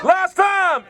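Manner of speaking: high shout over the singing; shouted cue. Real, not acted. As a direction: call the final round to the crowd. Calls out mid-chant to the crowd.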